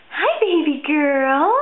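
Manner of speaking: slow, loud drawn-out greeting to the cat; slow cooing. Real, not acted. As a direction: stretch it out sweetly for the kitty. Greets her cat Rosie.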